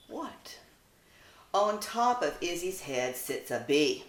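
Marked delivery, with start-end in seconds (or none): 0.1-0.6 soft breathy what; barely voiced what; silent what. Izzy the puppy turning to Zoe. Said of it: "as if to say".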